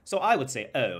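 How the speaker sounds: imitated British accent, mixing posh and street. Shown as a demonstration. Vlogger demonstrating his mimicry, imitated posh British accent. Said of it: "I get it mixed up with the posh".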